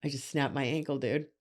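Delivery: low, soft, stunned calm; calm deadpan. Retold or imitated. Comedian telling a friend about an injury, calm. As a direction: report it quietly, without any alarm. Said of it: "I was so fucking calm".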